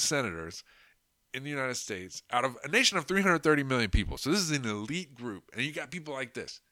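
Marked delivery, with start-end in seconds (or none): none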